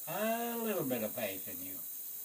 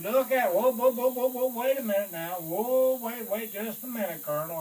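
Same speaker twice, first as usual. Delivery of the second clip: urgent calming; 8 semitones higher, 7 dB louder than usual. Retold or imitated. Sergeant urgently calming the colonel down. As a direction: wave him down, urgent and high.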